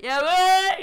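loud, high street call; calling across the block, a loud high yell; screaming out loud. Retold or imitated. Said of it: "just screaming out loud".

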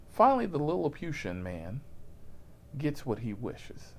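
usual speaking voice

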